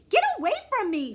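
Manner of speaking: high, loud disgusted scream; big sister screaming at the pest. Acted. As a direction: shriek to push him away. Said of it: "screams Violet".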